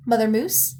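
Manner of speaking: usual speaking voice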